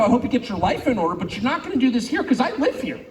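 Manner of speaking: ordinary talk, nothing marked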